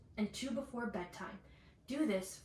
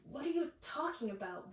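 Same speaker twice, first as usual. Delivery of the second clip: puzzled question; 4 semitones higher than usual. A narrator's reading. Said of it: "Victor asked".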